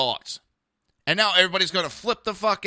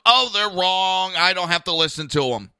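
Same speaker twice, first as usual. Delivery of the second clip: mock dismissal; 2 semitones higher, 8 dB louder than usual. Host parroting hypocrites who reject the court, loud dismissive mockery. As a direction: mimic someone stubbornly waving off a ruling.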